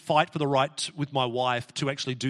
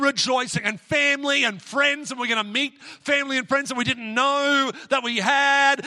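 rising preaching; 7 semitones higher than usual. Acted. Preacher telling church of the heavenly wedding feast, building excited preaching.

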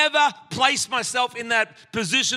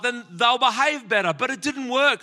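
ordinary talk

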